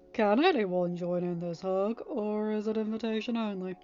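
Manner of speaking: teasing. A narrator's reading. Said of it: "Tony teased".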